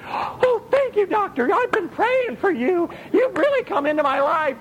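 very high, gushing mock gratitude; hammed-up grateful patient. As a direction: fawn over the doctor.

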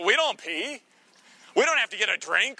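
usual speaking voice

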